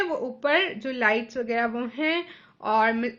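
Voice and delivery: ordinary delivery, nothing marked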